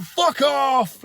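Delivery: imitating the road-rage yell at the man waving; angry yell. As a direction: bellow it at the other driver. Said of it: "And being angry, I'm like".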